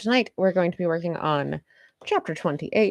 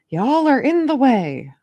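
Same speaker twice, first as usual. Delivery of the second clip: exasperated; 7 semitones higher, 7 dB louder than usual. Pretend. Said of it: "It's like".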